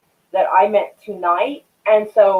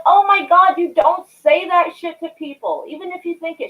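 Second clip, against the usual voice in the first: mock outrage; 10 semitones higher, 4 dB louder than usual. Retelling own shocked reply.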